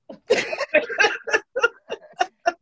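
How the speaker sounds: high, long laughter. Real, not acted. Host laughing at the childhood memory, long high laugh. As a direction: let the laugh run long.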